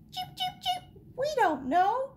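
tiny, very high cheeping voice; chirping chicks. A narrator's reading. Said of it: "chirped the chicks".

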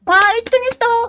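high, loud shriek of joy; shrieking jackpot winner. Real, not acted. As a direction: scream it like you just won. Winning first place in match.

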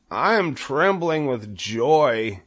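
high, drawn-out joyful voice; trembling with joy. A narrator's reading. Wilbur answers the spider, trembling with joy. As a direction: stretch it slowly with joy.

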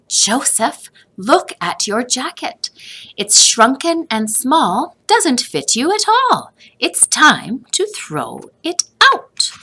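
a mother losing patience with the jacket; mother figure. A narrator's reading. Mother tells Joseph to throw the jacket out.

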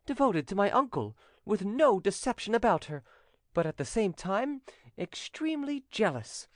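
high-pitched youth, vouching eagerly; high, animated nephew's panegyric; earnest young nephew. Acted. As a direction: plead her case in a high young voice. Voices nephew vouching for lady.

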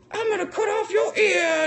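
mock villain threat. The torturer threatening the man in the chair, high mock voice. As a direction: squeak the threat. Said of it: "he's all like".

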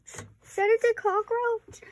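high, slow, alarmed question about Is that the cockroach; Is that the cockroach, pitched high and stretched out. Real, not acted. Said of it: "Oh no, okay, that's not".

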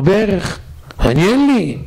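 a poor man crying out that he has nothing; high pleading admission. Retold or imitated. To the contractor, poor father pleads high and urgent.

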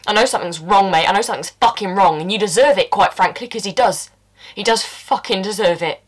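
loud, relentless gloating at the dying man; furious taunt. Acted. Taunting the poisoned husband on the carpet, furious. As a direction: hammer each word at him. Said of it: "And I'm like".

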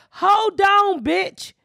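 furious call to the bus company about abandoned bags; retold phone outburst. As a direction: let him have it. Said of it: "So I called him and I said".

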